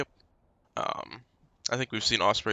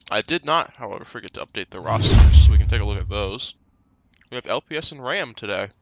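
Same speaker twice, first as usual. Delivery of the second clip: mouth full; 11 dB louder than usual. Real, not acted. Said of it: "Stop eating with your mouth full".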